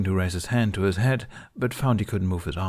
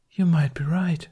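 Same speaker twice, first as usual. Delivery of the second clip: higher musing queen voice; 8 semitones higher than usual. A narrator's reading. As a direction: muse it thoughtfully. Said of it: "she mused".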